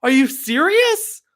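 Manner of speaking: real indignant outburst; heated disbelief. Real, not acted. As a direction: rant at the silly hack. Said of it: "Sorry, I get heated about that".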